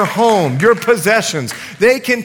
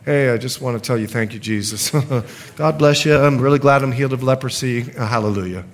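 half-hearted thanks; 7 semitones lower, faster than usual. Retold or imitated. Grateful leper shrugs off his healing in a lukewarm voice. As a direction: thank him as if it barely matters. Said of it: "do you think he went back and he was like".

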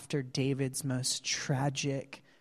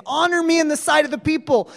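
fast, high and loud; a loud, fast mock of a proud king; proud demand; 11 semitones higher, 10 dB louder, much faster than usual. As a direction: blurt it out proudly.

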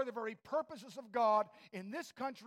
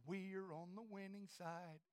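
hushed, lower declaration; quiet assurance; 4 semitones lower, 13 dB quieter than usual. Real, not acted. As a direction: drop right down, quietly confident. Quiet take, then repeated louder.

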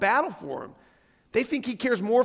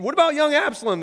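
high, louder urgent question; demanding; 7 semitones higher, 4 dB louder, faster than usual. A narrator's reading. King asking after young Absalom, demanding. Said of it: "the king demanded".

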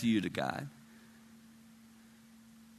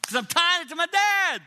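high, loud cry of realization; emphatic outburst; 14 semitones higher, 6 dB louder than usual. Real, not acted. Preacher explaining why he felt inadequate, emphatic outburst. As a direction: burst out, pitched way up. Outburst after the retold prayer.

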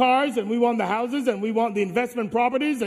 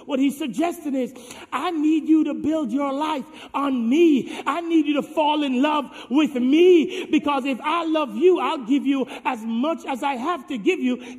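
higher, louder sermon delivery; fervent preaching; 5 semitones higher, 4 dB louder than usual. Acted. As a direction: press the students with conviction. Preaching to students.

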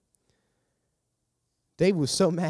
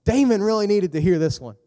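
judgmental listener; 7 semitones higher, 8 dB louder than usual. Acted. Same mock judgmental listener, louder.

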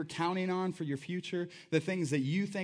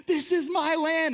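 much higher and louder, a triumphant claim; joyful claim; 11 semitones higher, 6 dB louder than usual. Retold or imitated. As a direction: proclaim it with bold joy. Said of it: "he's like".

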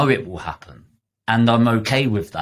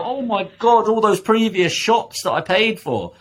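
much higher, whiny mock regret; mocking a shopper's dismay, much higher; 12 semitones higher than usual. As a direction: wail over the past shops. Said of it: "You don't go".

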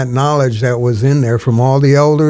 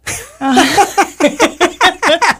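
big laugh. Real, not acted. Laughs at punchline.

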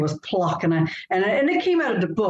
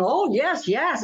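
higher, stubborn "yes, yes"; insistent yes; 7 semitones higher, slower than usual. Retold or imitated. Author retelling arguing with her editor, stubborn insistence. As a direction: insist like a writer defending her line. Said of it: "And I'm going".

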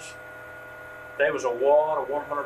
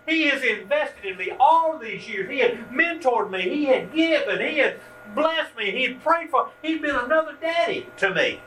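slow, much higher, voice full of feeling; moved; 7 semitones higher, slower than usual. Real, not acted. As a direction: speak of him with welling emotion. Moved recalling his mentor.

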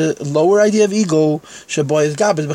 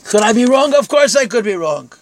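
raised, louder rhetorical question and answer; emphatic self-challenge; 6 semitones higher, 4 dB louder than usual. Real, not acted. Teacher admitting fallibility to the class, emphatic. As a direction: throw the question out high, answer it firmly.

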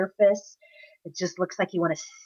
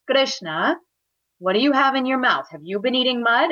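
higher, louder motherly interrogation; stern questioning; 6 semitones higher, 4 dB louder than usual. Acted. Mother questioning son about eating mud, stern. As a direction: demand a confession. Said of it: "very sternly".

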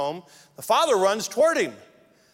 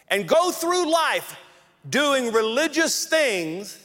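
rising fervour; 5 semitones higher than usual. Real, not acted. Preacher warning churchgoers, building fervent list. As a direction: build the list of church habits with mounting intensity.

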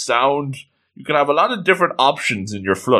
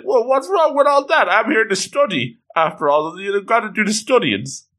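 higher-pitched, indignant retort; mock upset; 8 semitones higher than usual. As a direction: act out an upset student, voice pitched up. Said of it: "he gets upset".

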